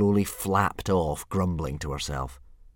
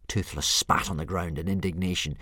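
plain reading voice

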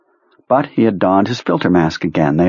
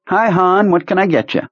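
much higher diner waitress voice; chirpy waitress; 11 semitones higher than usual. Acted. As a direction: greet him like a friendly waitress.

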